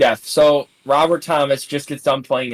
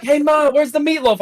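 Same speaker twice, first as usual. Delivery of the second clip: Will Ferrell; 13 semitones higher than usual. Retold or imitated. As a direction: yell like a grown man-child. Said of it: "it literally is like Will Ferrell".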